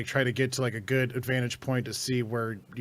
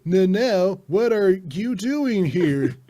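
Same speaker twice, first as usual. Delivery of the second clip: high, loud, slow stilted line reading; hammed-up imitation of a wooden voice actor; bad voice acting; 7 semitones higher, 8 dB louder, much slower than usual. Mocking the game's hero actor, high stilted read. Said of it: "he delivers it like all his lines".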